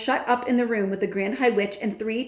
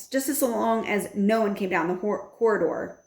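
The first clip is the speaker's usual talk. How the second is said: plain reading voice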